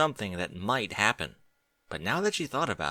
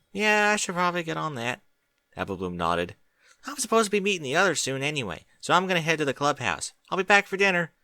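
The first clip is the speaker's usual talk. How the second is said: Apple Bloom voice; 7 semitones higher than usual. A narrator's reading. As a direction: pitch it up as an eager young filly.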